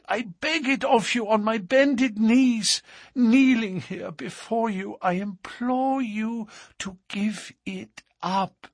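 put-on pleading young woman's voice; Etty begging. A narrator's reading. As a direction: beg him from her knees. Etty begs on her knees.

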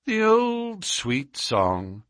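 slow, high, savouring detective; teasing, lingering lilt; amused Holmes. A narrator's reading. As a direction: draw it out in wry nostalgia. Detective mocks the threat.

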